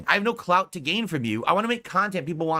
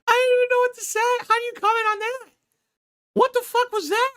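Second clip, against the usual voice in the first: mocking squeak.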